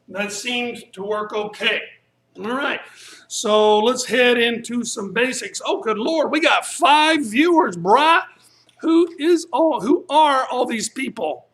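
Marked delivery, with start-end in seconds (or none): 5.6-11.5 surprised. Real, not acted. Surprised by many stream viewers.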